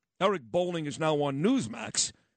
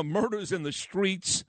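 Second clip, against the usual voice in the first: normal talking voice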